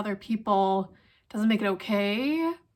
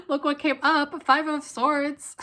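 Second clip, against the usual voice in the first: real laughing delivery, pitched higher; higher-pitched, tickled by the card drawn; amused; 6 semitones higher than usual. Real, not acted.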